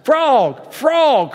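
high, loud excited call; crying out. A narrator's reading. Toad running over to Frog's house, crying out. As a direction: call out for a friend by name. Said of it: "cried Toad".

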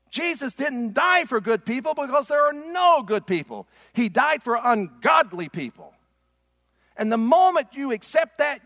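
high, loud preaching climax; preaching at full force. Acted.

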